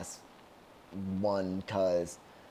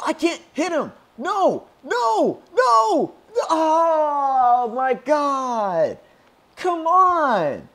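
very high, loud wails of defeat; anguished screaming; 10 dB louder, much slower than usual. Real, not acted. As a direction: scream in helpless frustration as you lose. Losing final fight, screaming.

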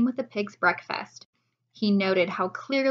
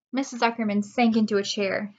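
ordinary delivery

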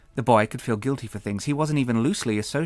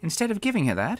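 ordinary delivery, nothing marked

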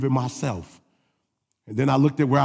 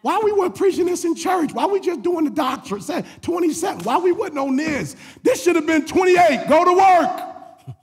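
high, strained, impassioned exhortation building louder; full-force preaching; 11 semitones higher, 3 dB louder than usual. Real, not acted. Preacher urging church to activate gifts, high impassioned preaching. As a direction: cry it out high and urgently to the congregation.

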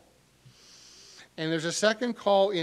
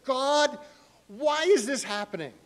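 higher and louder, crying out the question; anguished cry to God; 8 semitones higher, 8 dB louder than usual. Retold or imitated. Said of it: "where you've said".

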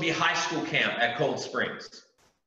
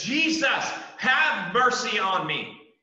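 loud, much higher desperate cry, slow on mercy; 9 semitones higher, 5 dB louder, slower than usual. Acted. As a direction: cry out to Jesus with everything you have. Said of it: "He cries out to".

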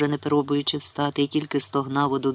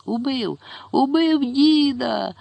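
much higher, slow, breaking cry; voice-cracking scream; 10 semitones higher, 3 dB louder, much slower than usual. A narrator's reading. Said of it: "закричав, зриваючи голос".

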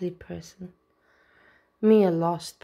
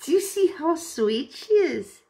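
squealing delight; 10 semitones higher, 3 dB louder than usual. Real, not acted. Gushing over Anne's video.

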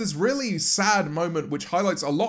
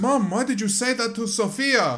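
put-on child voice; 5 semitones higher than usual. Child asking mother.

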